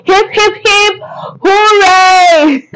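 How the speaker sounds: high, loud celebratory cheer; shouting. Real, not acted. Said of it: "I'm shouting".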